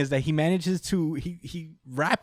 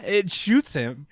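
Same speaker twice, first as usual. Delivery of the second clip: ordinary talk, nothing marked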